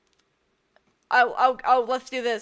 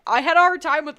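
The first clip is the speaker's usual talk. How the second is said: ordinary talk, nothing marked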